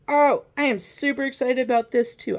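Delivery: loud, high excited burst. Acted.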